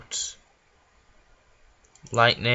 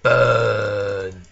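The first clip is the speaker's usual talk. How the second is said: gleeful villain; 3 semitones lower, 4 dB louder than usual. Pretend. Villain burning the bar.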